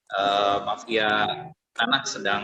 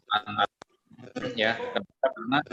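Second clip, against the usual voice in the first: usual speaking voice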